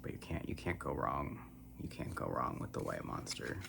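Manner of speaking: ordinary talk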